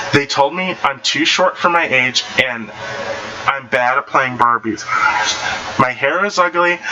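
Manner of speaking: plain reading voice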